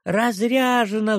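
high squealing shout, drawn out. A narrator's reading. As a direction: squeal it like a small child. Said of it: "взвизгнула Миуша". (визг маленькой девочки)

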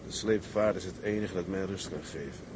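low, soft, inward admission; subdued confession. Acted. Haunted captain confiding what gives him rest, subdued. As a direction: let the voice sink as you confess. Quiet confession closing the scene.

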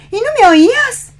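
high, teasing surprise; can't believe he didn't hear; playful disbelief. Acted. Reproche juguetón, incrédulo. (sorpresa burlona, aguda)

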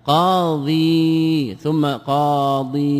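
قاضي then قاضين stretched very slowly, syllable by syllable; very slow grammar demo; drawn-out demo. Shown as a demonstration. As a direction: spell out قاضين slowly for the class. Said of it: "لا، دون ال طبعًا".

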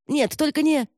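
high, alarmed protest cut short; sudden high-pitched dread; panicked pleading. Acted. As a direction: beg as the blow comes. Captive about to be hit.

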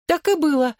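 high, bright-spirited chirp; gleeful, lilting confirmation; cheerful chirping. A narrator's reading. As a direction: chirp it happily, pitched right up. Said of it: "весело прощебетала Клэр".

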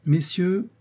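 high-pitched triumphant announcement. A narrator's reading. Lady to those around her, promising a cure, triumphant. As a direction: proclaim it as a sure victory. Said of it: "d'un ton triomphant".

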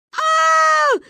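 put-on high, loud children's scream; yelling scream. A narrator's reading. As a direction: scream in alarm. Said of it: "заорали мы". (истошный детский вопль)

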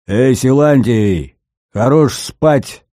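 loud, slow booming call; booming bass call. A narrator's reading. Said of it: "загрохотал прокуренный бас".

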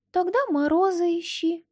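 high, pert little doll; bold doll voice. Acted. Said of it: "doll answers boldly".

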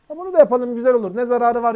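imitating someone pushing an idea; mock casual suggestion. Voices people arguing for an innovation.

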